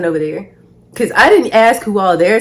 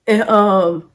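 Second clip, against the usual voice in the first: usual speaking voice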